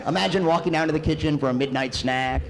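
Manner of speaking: ordinary talk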